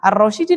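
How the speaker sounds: "Ar-Rasyidi" with a final i in place of u; wrong i ending. Shown as a demonstration. Teacher rules out a reading for the class, "Ar-Rasyidi" ending in i. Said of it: "ndak boleh karena ngikut".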